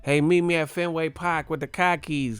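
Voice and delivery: mock Boston accent. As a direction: imitate a Bostonian asking for car keys. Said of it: "That's how they be talking in, in Boston".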